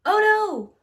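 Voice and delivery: hammed-up alarm for the children; mock alarm. Narrator cries out at running out of pages.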